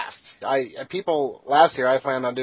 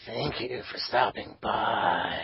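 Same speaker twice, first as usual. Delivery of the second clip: slow, low, breathy creepy whisper; sinister slow villain drawl; creepy Resident Evil voice; 3 semitones lower, 9 dB quieter, much slower than usual. Pretend. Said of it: "That's my creepy Resident Evil voice".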